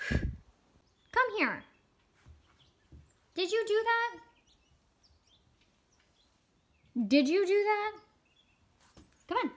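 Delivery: high, mock stern questioning of the dog; pet scolding. Real, not acted. Owner questioning her dog about a mess, high mock scolding.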